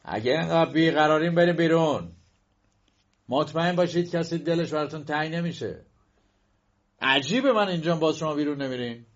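a student snapping at a pest; angry young man. A narrator's reading. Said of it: "مرد جوان با عصبانیت گفت". (مرد جوان عصبانی، با صدای بالاتر و تند)

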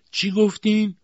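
very high, loud query; sharp "what?". A narrator's reading. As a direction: call out as if you didn't hear. Aunt unplugs ears, did not hear.

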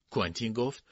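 usual speaking voice

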